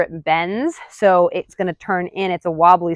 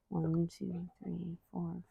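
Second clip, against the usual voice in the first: much softer, barely voiced counting; under-breath count; 24 dB quieter, slower than usual. Real, not acted. Trainer counting duration under her breath. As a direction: count quietly to yourself.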